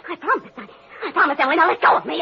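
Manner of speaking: high, breathless pleading; frightened promise. Acted. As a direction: promise anything just to be freed. Daisy begging to be released.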